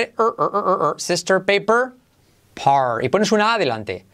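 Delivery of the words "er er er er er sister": "er, er, er" as a long r-coloured sound; R drill. Shown as a demonstration. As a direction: repeat "er" steadily before "sister". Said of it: "trabajas primero la R". (repite "er" como R genérica)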